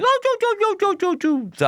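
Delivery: put-on very high cooing baby talk, falling back down. Shown as a demonstration. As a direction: coo at a baby in a sing-song. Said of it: "adults doing baby voice".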